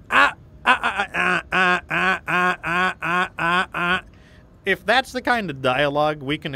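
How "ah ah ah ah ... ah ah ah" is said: loud villain cackle; evil laugh. Retold or imitated. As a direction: cackle like a cartoon bad guy. Evil laugh after villain taunt.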